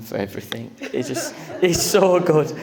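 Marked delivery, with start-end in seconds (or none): 1.6-2.5 higher, laughing praise; laughing while talking. Real, not acted. Groom praising bride's planning, laughing as he talks. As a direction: let real laughter carry the words upward.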